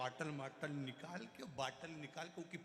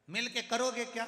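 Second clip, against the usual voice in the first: higher, louder exasperated shout; shouting grandson; 9 semitones higher, 9 dB louder than usual. Retold or imitated. Grandson shouts at deaf grandfather, exasperated. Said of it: "नाना चिल्ला कर बोला".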